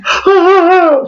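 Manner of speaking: a hammed-up crying wail that breaks into laughter; mock wail. Vlogger hams up the crying bride with a laughing wail. Laugh-cry burst, very high.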